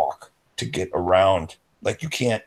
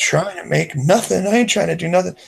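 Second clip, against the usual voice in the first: defensive friend; 10 semitones higher than usual. Retold or imitated. Voicing Adam's defensive reply.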